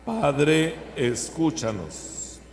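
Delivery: loud call, slow drawn-out ending; loud liturgical response. Acted. Congregation response to prayer.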